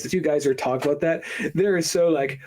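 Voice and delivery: usual speaking voice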